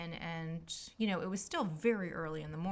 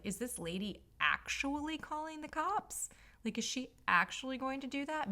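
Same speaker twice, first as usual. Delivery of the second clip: higher, worried self-quote; mock panic; 6 semitones higher than usual. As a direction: imitate your own alarmed thought. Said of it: "I was like".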